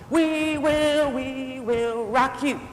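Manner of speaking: high, drawn-out battle chant; war cry. Acted. Army marching to battle shouting their war cry, high chant. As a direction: chant it slowly like marching troops. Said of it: "shouting their war cry".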